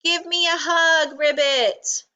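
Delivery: high, joyful call; excited shout. A narrator's reading. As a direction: exclaim it with delight. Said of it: "shouted Rabbit".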